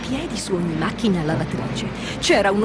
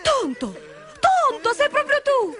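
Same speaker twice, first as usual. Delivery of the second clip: very high, excited character voice; amazed recognition; 13 semitones higher than usual. Acted. Friends find Tonto alive, amazed and excited. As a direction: burst into high-pitched astonished questions.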